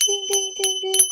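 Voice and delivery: high-pitched ringing "ding" on repeat; imitated bell. Teacher rewards a student's correct answer, imitated bell. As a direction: chime like a winner's bell, high.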